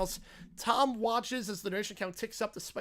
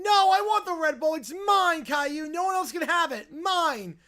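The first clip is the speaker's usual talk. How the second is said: high, loud tantrum shriek; screaming bratty kid; 8 semitones higher, 8 dB louder than usual. Acted. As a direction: scream it like a spoiled child who won't share. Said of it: "Lemon Bear is screaming".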